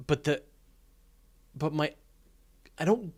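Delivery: normal talking voice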